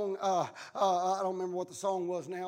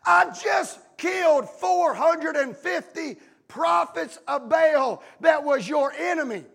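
high, drawn-out protest; indignant retort; 7 semitones higher, 11 dB louder, slower than usual. Acted. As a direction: protest it slowly, phrase by phrase. Elijah indignantly answering God, acted.

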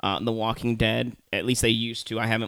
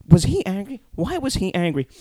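higher-pitched parody of probing questions; mocking questions; 6 semitones higher than usual. Podcaster parroting the host's pointless questions, mocking. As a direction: feign puzzlement, pitched up.

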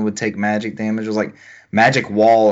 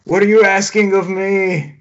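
much higher, slow, whiny put-upon voice; the game talking back; 10 semitones higher, 4 dB louder, slower than usual. Pretend. Co-host voicing the game's whiny reply, high and slow. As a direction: play the offended game pleading.

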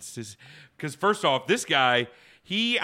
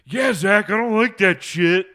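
louder, higher mock whiny voice; imitating Blake; 8 semitones higher, 11 dB louder, slower than usual. Mocking his friend's fright, whiny imitation. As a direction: whine it like a scared friend. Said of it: "he goes".

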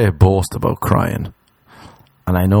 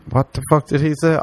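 normal talking voice

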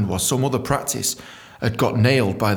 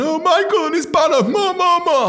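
high, loud jeering imitation; hammed-up taunting babble; mock scoffing; 5 dB louder than usual. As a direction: mimic colleagues sneering, pitched way up. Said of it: "they started scoffing me".

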